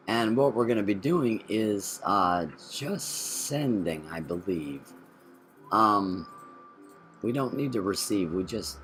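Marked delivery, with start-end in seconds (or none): none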